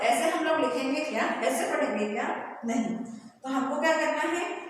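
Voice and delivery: usual speaking voice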